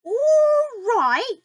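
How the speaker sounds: high outraged shriek; yelled. A narrator's reading. Grandma yelling back at George, shrieking outraged. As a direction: screech it back at him. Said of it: "she yelled".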